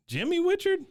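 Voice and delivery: high squeal of disbelief; incredulous shriek. Retold or imitated. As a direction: screech the name in shock. Dale incredulous at name.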